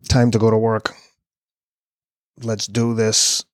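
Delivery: steady, determined self-talk; inner voice. Retold or imitated. Answering what his inner voice says at mile twenty, steely and determined. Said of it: "What is your inner voice telling you".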